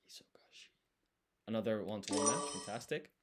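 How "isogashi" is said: isogashi whispered to himself. Shown as a demonstration. Whispering isogashi while writing it out. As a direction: breathe isogashi with no voice. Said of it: "quiet repeat of the word".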